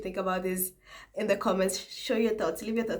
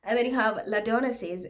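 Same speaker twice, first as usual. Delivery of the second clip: ordinary delivery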